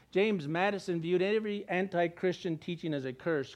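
usual speaking voice

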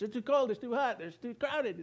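mock complaining. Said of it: "sitting here in church".

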